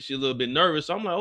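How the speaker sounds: ordinary talk, nothing marked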